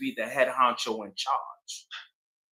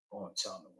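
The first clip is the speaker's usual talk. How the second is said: usual speaking voice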